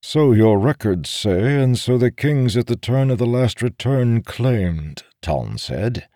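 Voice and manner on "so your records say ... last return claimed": raised, challenging character voice; pointed, argumentative reply; skeptical warrior. Acted. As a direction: dispute the official records.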